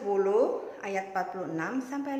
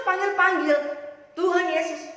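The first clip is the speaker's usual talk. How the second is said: loud calling; 6 semitones higher, 7 dB louder than usual. A narrator's reading. Storyteller echoes the calling.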